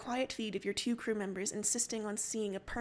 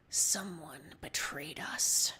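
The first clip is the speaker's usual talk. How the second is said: low, slow hushed whisper; 5 semitones lower, much slower than usual. Acted. Captain realizing betrayal, whispering to Solix. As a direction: breathe it out in dread. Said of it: "you whisper".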